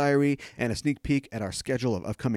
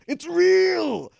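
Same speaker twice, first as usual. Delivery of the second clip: high, loud, drawn-out outburst; Sisko; 7 dB louder than usual. Acted. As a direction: cry it out in hammed-up anguish. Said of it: "or Sisko's".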